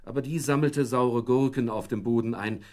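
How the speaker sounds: plain reading voice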